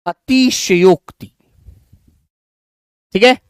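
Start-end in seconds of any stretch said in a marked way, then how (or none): 0.1-1.3 अतिशयोक्ति said slowly with a full शय, not a half one; slow model pronunciation for students; careful pronunciation. Shown as a demonstration. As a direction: stretch अतिशयोक्ति so every syllable is heard. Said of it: "आधा शय बना देते हैं बच्चे".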